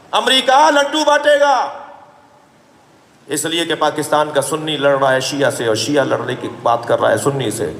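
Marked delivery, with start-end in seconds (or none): 0.1-1.8 sarcastic jab. Real, not acted. Preacher mocking enemies' celebration.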